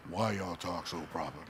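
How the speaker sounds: slow, low Florida drawl; Florida kids' accent. Retold or imitated. Florida kids questioning the newcomers, slow drawled accent. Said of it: "Kids on our bus".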